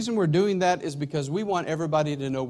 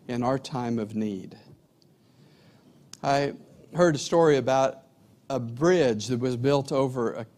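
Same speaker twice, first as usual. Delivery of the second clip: usual speaking voice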